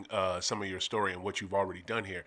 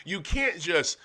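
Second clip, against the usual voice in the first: ordinary talk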